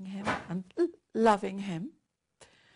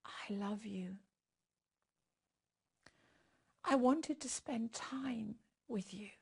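hushed whisper to God; tender whispered prayer; 5 dB quieter than usual. Real, not acted. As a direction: breathe the words as a private confession. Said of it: "whispered prayer".

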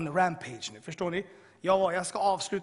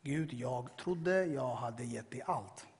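drawn-out, lower confession to God; slow, deliberate repeat; 5 semitones lower, 4 dB quieter, much slower than usual. Retold or imitated. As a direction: spell it out slowly, word by word. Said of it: "Direkt när jag sa det från min mun". (långsamt, eftertryckligt och lågmält)

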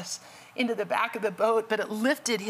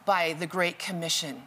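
usual speaking voice

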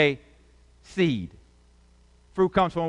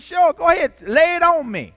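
much higher, louder bright sure sure sure; innocent eager yes; 8 dB louder than usual. Retold or imitated. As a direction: play the naive friend happily agreeing. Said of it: "You know, just innocent".

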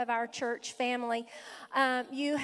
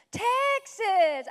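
higher, drawn-out delighted "Texas"; delighted call-out; 6 semitones higher, 4 dB louder than usual. Real, not acted. Pastor echoing a guest's home state, delighted.

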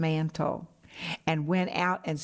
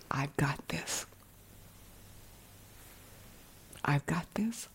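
hushed whisper, much softer, rising at the end; still small voice; 4 semitones lower, 11 dB quieter than usual. Retold or imitated. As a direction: whisper it like God's quiet reassurance. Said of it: "I hear that still small voice".

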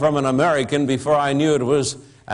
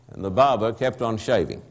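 low, softer dry refrain; 4 semitones lower, 4 dB quieter than usual. Acted. Narrator's refrain about the silent barber, low and soft. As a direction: drop it low and deadpan.

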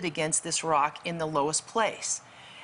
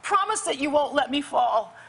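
higher, urgent begging for a promise; tearful pleading; 7 semitones higher than usual. Retold or imitated. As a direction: plead like someone clinging to the rock. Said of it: "she's just weeping".

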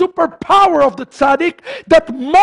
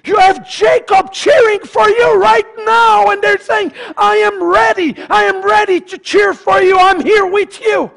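high, ringing rallying cry; full-force preaching; 9 semitones higher, 4 dB louder than usual. Real, not acted. Preacher voicing patriarchs cheering congregation on, full-force preaching. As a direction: burst out like a cheering supporter.

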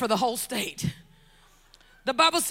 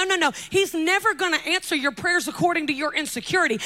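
ordinary talk, nothing marked

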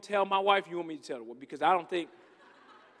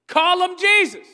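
slow, loud and higher; loud emphatic call; 7 semitones higher, 11 dB louder than usual. Real, not acted. Preacher calling out loudly to the congregation. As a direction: call out the name.

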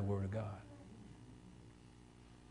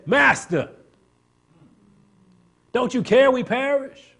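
desperate cry; 12 semitones higher, 8 dB louder, slower than usual. Retold or imitated. Disciples crying out in storm.

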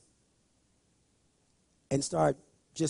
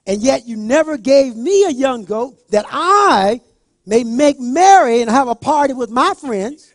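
put-on resentful older son; 3 semitones higher, 8 dB louder than usual. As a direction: throw it at the father like a grudge. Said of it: "So he answered and said to his father".

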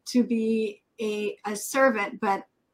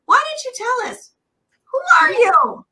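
excited call-out; 11 semitones higher, 6 dB louder than usual. Real, not acted. Host calls on guest excitedly.

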